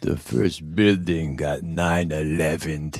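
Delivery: dim tough guy solemnly narrating his documentary; Stallone. Acted. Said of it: "like, the punchy Stallone".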